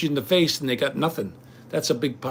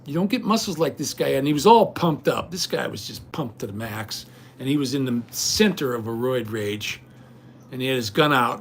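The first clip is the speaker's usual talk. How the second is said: ordinary talk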